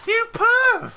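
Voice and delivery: very high, playful squeal of accusation; mock outrage. Scolding a stranger for taking a screen print, mock-outraged squeal. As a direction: shoot the pitch right up in mock indignation.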